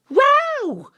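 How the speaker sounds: very high, loud wow; thrilled exclamation; amazed wow. Acted. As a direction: gasp it out in wonder. Walks through wall herself.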